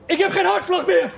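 high, half-laughing panic; mock panic. Stoned man tells his friend his heart stopped, mock panic. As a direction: blurt it out in a comic fright. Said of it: "Ik zeg".

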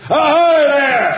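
high, drawn-out sailor's hail; shouting. Acted. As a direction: shout a long "ahoy" across the water. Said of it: "Who are you shouting at?".

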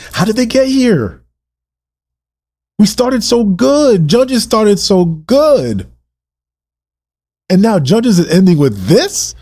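high-pitched, incredulous lament; emotional, passionate preaching. Real, not acted. Preacher lamenting how Judges ends, passionate. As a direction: cry it out, stretching the key words. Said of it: "if I get emotional, I get passionate".

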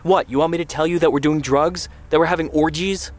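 high-pitched defiant outburst; exasperated cry. A narrator's reading. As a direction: fire it back sarcastically at mother. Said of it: "Aryeh cried".

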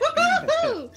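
high whooping cheer. Real, not acted. Cheering the song.

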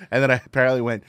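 ordinary talk, nothing marked

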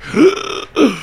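high sharp gasp of fright. Acted. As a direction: gasp like you just saw it. Ghost story climax.

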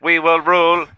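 robot voice. Acted. AI characters argue in voices.

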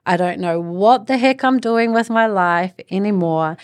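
ordinary delivery

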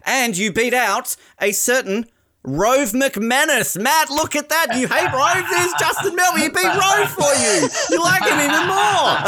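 high, building excitement, ending in laughter; triumphant reveal. Real, not acted. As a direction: crow over the big reveal, pitched right up. Teasing Matt with a triumphant reveal.

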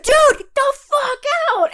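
very high, imitated outraged order; mock furious yell. Retelling the boyfriend ordering the staring guy out, high mock shout. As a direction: bark him out of the room.

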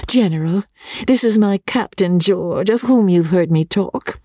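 high, eager little lady's voice; effusive high-pitched introduction; gushing Rebecca. Acted. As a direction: present him proudly in a high, effusive voice.

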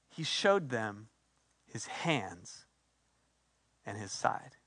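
low, slow and very soft; solemn, sinking almost to a murmur; hushed scripture reading. A narrator's reading. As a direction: read it with hushed reverence, pausing between phrases. Solemn reading of the key verse.

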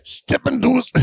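high, strained stab at a catchphrase; wrestler catchphrase. Acted. Host attempting a wrestler's line, high strained impression. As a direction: try the wrestler voice and give up. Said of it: "like, what if you said".

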